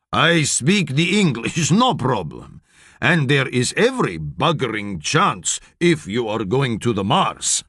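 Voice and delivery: a Russian trainer speaking broken English; Russian accent. Acted. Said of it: "says the big man".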